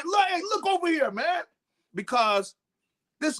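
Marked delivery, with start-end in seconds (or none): 0.0-1.4 mock yell. Linebacker yelling to the safety for help, half-hearted mock yell. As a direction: call a teammate over, higher and urgent. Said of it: "I'm yelling to the safety".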